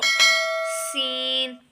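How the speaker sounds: सीन very slow, with a whistling s; whistling sibilant. Shown as a demonstration. Teacher repeating the whistle-like letter, whistling sibilant. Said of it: "जैसे सीटी की आवाज़ निकलती है".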